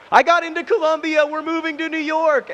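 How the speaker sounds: high, delighted squeal of news; imitating her thrilled scream; excited scream. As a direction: shriek it like you just got in. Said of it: "she calls me screaming".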